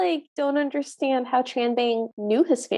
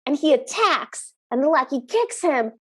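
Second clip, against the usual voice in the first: ordinary talk, nothing marked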